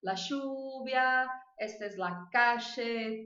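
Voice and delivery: Rioplatense Spanish with LL as "sh"; slow Argentine-accented Spanish examples. Shown as a demonstration. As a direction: slip into an Argentine accent, hushing each LL. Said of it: "quando eu tô na Argentina".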